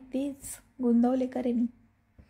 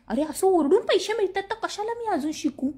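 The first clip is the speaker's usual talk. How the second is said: higher-pitched mock yelling; mild demonstrative yell; 6 semitones higher than usual. Shown as a demonstration. Live host showing how she yells for money, mild yell. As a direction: pitch it up into a half-shout. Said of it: "आता मला असं ओरडायचंय".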